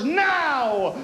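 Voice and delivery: building to a high, slow shout; rising, incredulous outrage; indignant rant. Acted. Rising outrage about hair.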